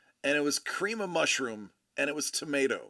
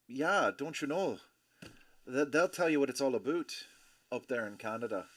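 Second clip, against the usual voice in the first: lightly hammed-up Canadian accent; soft-spoken mock Canadian; 2 semitones lower, 5 dB quieter than usual. Shown as a demonstration. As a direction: put on a mild Canadian lilt. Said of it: "a third of them have an accent".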